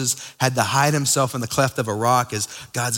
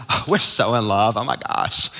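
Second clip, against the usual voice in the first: lightly played swooning, ending in a whisper; mock gushing lover; 5 semitones lower than usual. Preacher mimics lovestruck talk for the church, playful mock gush. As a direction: gush like a smitten teen, mildly hammed-up. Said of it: "We, we say this in breakups".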